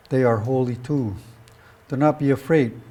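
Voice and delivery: ordinary delivery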